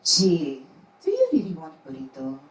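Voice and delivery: put-on thick Japanese accent, nagging mother; mom's Japanese accent. Comedian mimics her Japanese mom's thick accent. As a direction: imitate your mom's heavy Japanese accent scolding you. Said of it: "I hear her saying".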